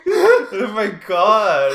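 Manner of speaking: slow, loud laugh-cry; helpless laughter on each word. Real, not acted. Breaking up laughing.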